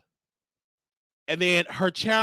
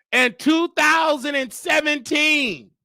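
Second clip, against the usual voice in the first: slow, higher-pitched proclamation; mock grand announcement; 5 semitones higher, 3 dB louder, slower than usual. Returning guest revealing the eviction date, mocking proclamation. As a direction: stretch out the date like a big reveal.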